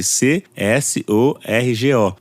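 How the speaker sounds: C S O R G O, slowly letter by letter; spelled out. Shown as a demonstration. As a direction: spell C S O R G O one letter at a time.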